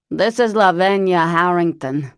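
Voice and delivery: loud, put-on voice of an old chain smoker; Lavinia's horrible voice. Cousin Lavinia phoning Parker, loud put-on smoker's voice. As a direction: bark it out like a lifelong smoker. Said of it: "that was some accent".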